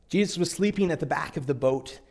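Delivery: ordinary talk, nothing marked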